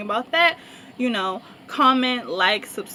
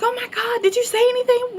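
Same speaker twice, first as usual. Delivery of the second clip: much higher excited squeal; gushing friend; 13 semitones higher than usual. Retold or imitated. Friend asking if she spoke to him, excited. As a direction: gasp it out, pitched up. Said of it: "And she's like".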